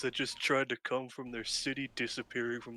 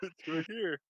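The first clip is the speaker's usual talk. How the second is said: mock quote laughing; 5 semitones higher than usual.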